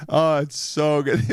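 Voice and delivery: hammed-up foodie delight; mock raving. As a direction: rave slowly, about to crack up. Diner raving to someone else.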